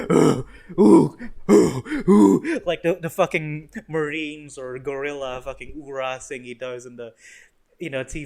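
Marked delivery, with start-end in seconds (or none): 0.1-2.6 loud, punchy hoots; mock Marine hooting. Punisher doing his oorah thing, loud mock hoots. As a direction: hoot it out like a grunt.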